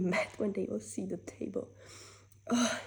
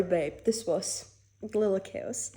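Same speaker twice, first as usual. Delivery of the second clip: ordinary talk, nothing marked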